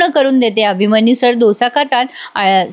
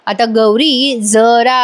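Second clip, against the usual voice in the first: usual speaking voice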